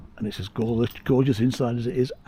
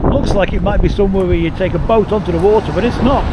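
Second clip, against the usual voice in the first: raised voice over gusts; shouting over wind; 4 semitones higher, 8 dB louder than usual. Real, not acted. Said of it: "Sorry about the wind and the noise".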